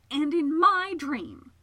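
high, loud, drawn-out interjection; indignant. Acted. Segundus adds his own complaint to hers, indignant. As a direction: insist on your grievance, stretching it out. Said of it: "did not wish his indignation to be overlooked".

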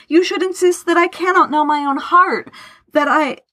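high-pitched, impassioned anguish; trembling outcry. A narrator's reading. Laurence pours out five years of missing his friend, anguished outcry. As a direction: pour it out, pitch held high. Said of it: "cried, a tremble in his voice".

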